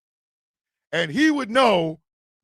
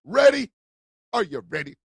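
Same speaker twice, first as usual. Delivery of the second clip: usual speaking voice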